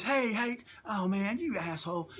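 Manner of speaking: high start, falling, no real volume; mock yelling. Wrestler spots his old heckler, mock yelling. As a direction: imitate an indignant yell without shouting. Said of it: "he starts yelling at me".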